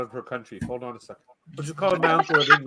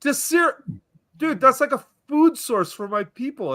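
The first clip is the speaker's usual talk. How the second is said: excited; 9 semitones higher than usual. Real, not acted. Excited mock-patriotic syrup praise.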